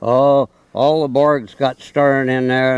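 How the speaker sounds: ordinary talk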